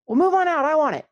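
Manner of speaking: high, pushy mock demand to clear the way; entitled inner voice. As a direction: play your own greedy inner voice, high and loud. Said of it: "I found myself thinking".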